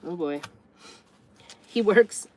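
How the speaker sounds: usual speaking voice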